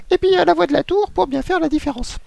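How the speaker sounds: very high squeaky controller voice; control tower voice. Shown as a demonstration. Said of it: "la voix du contrôleur".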